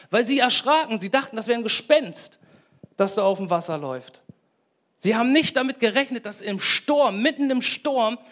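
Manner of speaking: high, loud, building preacher's cry; fiery sermon climax, high and loud; preaching at full force. Real, not acted. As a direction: rise into it and proclaim it.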